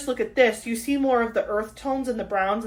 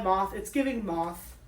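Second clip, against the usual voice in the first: ordinary talk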